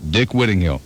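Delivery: ordinary talk, nothing marked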